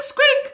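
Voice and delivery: very high squeak; mouse squeak. Acted. Storyteller squeaking as the mouse for kids. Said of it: "animal that went".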